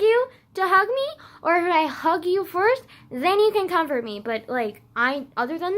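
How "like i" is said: ordinary delivery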